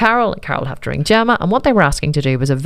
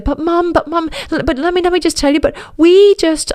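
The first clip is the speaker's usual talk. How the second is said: put-on much higher, pleading teen voice; 12 semitones higher than usual. Teen daughter pleading with her mother, high and urgent. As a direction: beg Mom to let her explain.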